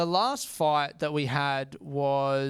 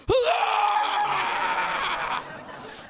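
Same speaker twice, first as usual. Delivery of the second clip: very high, long mock effort yell; straining weightlifter roar; 13 semitones higher than usual. Dad forces himself into a hug, mock straining roar. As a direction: heave out a hammed-up lifting roar. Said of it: "my dad pushing through an emotional barrier".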